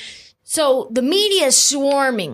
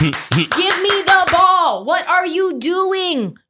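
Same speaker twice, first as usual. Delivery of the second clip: demanding yell; 8 semitones higher, slower than usual. Retold or imitated. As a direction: shout for the ball, fed up. Acted on-court quarrel, Durant demanding.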